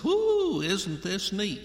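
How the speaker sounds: high whooping "Whoo", then a lighter mock-impressed question; hammed-up wide-eyed wonder; mock amazed whoo. Preacher imitating Ezekiel gawking at the wheels, mock amazement. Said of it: "And he said".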